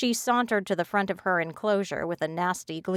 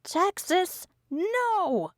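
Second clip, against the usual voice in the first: very high, wild roar; 12 semitones higher, much slower than usual. A narrator's reading. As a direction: roar it out, all softness gone. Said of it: "roared more loudly than I thought possible".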